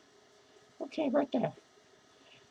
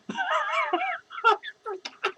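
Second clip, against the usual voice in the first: high giggling laugh; 13 semitones higher, 6 dB quieter than usual. Real, not acted. Forgetting what he said, high helpless giggle. Drunk vlogger forgets his point.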